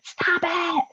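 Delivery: high-pitched "Stop it." blurted out; exasperated stop it. Shown as a demonstration. Parent blurting at kids, high exasperated snap. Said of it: "it's different than just going".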